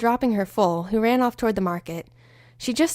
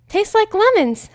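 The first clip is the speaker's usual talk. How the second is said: lightly voiced Pinky; 10 semitones higher, 4 dB louder, slower than usual. Pinky's voice in the story.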